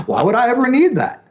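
high-pitched imitation of a baffled skeptic; mock disbelief. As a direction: play the doubter dismissing a useless gadget. Retelling past skeptics of pagers.

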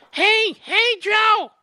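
mock a kid reporter yelling for attention; prepubescent Brian Stelter. Host mimicking a young reporter calling out to Biden, squeaky high. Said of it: "didn't that sound like prepubescent Brian Stelter".

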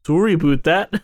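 mock-Canadian apology with "about" as "aboot"; put-on Canadian, rhyme "about" with boot; exaggerated Canadian "aboot"; corrected Canadian accent. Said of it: "It's sorry about that".

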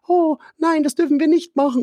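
high, pleading angel voice; little angel voice. Acted. Angel on the shoulder warning, high and pleading. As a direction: squeak it out like a worried little angel. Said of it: "das Engelchen, das sagt".

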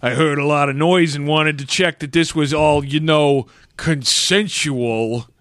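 slow, drawn-out New Yorkese; mock New York accent. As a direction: stretch the key words in a New York accent. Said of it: "in that accent".

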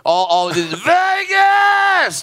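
long stretched high shout of Vegas; mock casino yell. Imitating casino crowds yelling Vegas. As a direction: yell it like a drunk on the casino floor.

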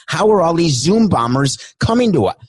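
ordinary talk, nothing marked

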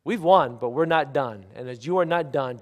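usual speaking voice

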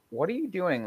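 incredulous. Retold or imitated. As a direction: react as if the team lost its mind. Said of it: "and I'm like".

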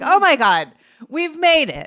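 high, loud, thrilled cry; excited squeal. Retold or imitated. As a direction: shriek with delight at success. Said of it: "We're like".

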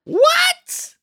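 very high squealed disbelief; mock astonishment. Host overreacting to a guest's podcast plug, mock astonished. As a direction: shriek it in pretend shock.

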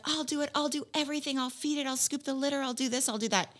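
high, soft eager pleading; promising child. Retold or imitated. Daughter pleading for a cat, eager and high. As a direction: promise everything like a kid. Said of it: "she's like".

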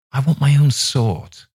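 a hint of a stubborn boy; boy Miles. A narrator's reading. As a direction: state it plainly as the schoolboy. Boy blurts his wish.

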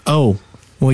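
normal talking voice

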